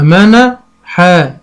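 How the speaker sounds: منحا drawn out very slowly, syllables joined; slow blending. Shown as a demonstration. Teacher blending syllables for a child, slowly. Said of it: "أنطقها أقول إيه".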